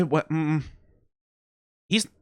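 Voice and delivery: ordinary delivery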